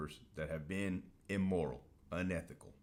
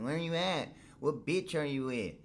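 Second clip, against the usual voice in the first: high-pitched mock wife demanding answers; nagging wife impression; 7 semitones higher than usual. Wife demanding to know where her husband is, high mocking imitation. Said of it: "My wife absolutely fucking hates it. Always like".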